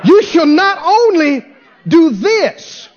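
high-pitched, exultant preaching; getting happy. Real, not acted. Preacher thrilled by the promise to the congregation, getting happy. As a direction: proclaim it with rising joy. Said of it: "I'm about to get happy myself".

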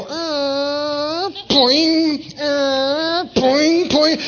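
little plucked poing sounds for breaking threads; snapping thread noises. Acted. Preacher mimicking the net's threads snapping: playful poing sounds.

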